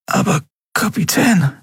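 put-on high, brittle young voice; cracked voice. A narrator's reading. Miko comforts Endriel with a broken voice. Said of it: "mit brüchiger Stimme".

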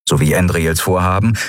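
ordinary delivery, nothing marked

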